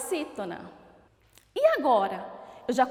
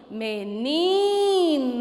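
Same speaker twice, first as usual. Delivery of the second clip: "Menino" with a long, called-out "ni"; stressed syllable stretched; 4 dB louder, much slower than usual. Shown as a demonstration. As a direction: call "Menino" slowly, holding the middle syllable. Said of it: "Qual é a sílaba tônica?".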